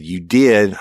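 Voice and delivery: a loud retold retort; retold forceful shout. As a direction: slam the accusation down. Insisting against the suspect's denial.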